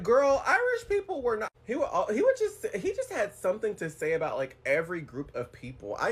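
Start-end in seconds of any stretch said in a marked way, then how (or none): 0.0-1.5 high, loud sassy retort; sassy comeback. Retold or imitated. Retelling her reply to the ignorant coworker, sassy and loud. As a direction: cut him down with a sharp high comeback.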